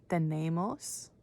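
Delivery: "Tenemos" drawn out slowly, syllable by syllable; slow model word. Shown as a demonstration. Teacher models "Tenemos" slowly for kids to repeat. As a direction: stretch out "Tenemos" for learners. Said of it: "Can you say that?".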